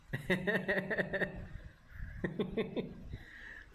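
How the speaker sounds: long, high cackling giggle; amused laugh. Real, not acted. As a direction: crack up at your own joke. Laughs after royalty split joke.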